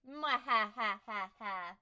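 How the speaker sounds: slow mad scientist cackle; evil laugh. Pretend. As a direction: cackle like a cartoon villain. Evil laugh after plug.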